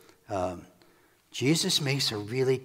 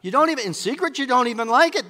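heated accusation, high and loud; high-pitched loud challenge; loud confrontation; 11 semitones higher, 6 dB louder than usual. Acted. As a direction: confront them, voice raised and climbing. Acted quarrel, Jesus confronting accusers.

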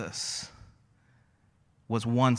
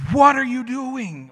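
high, loud, drawn-out outburst; acted shriek of parental disbelief; exasperated dad; 11 semitones higher, 11 dB louder than usual. Dad finding son's overflowing toilet, exasperated yell. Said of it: "my countenance in that moment was".

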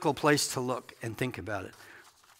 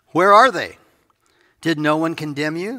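raised, ringing question; a loud searching question to the woman; 3 semitones higher, 7 dB louder than usual. Acted. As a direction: call out the question to her.

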